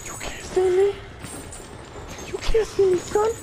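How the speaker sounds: very high, squeaky mock voice; taunting squeal. Player telling monster it can't see them, squeaky taunt.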